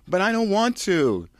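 high, loud pouty whine; whiny Kirsten. Acted. Kirsten refusing the director, high pouty whine. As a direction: pout it.

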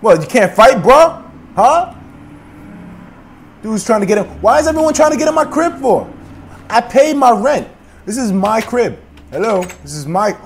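very high, loud rant; frantic yelling. Acted. Player screaming that the house is his crib. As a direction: rage at the intruders.

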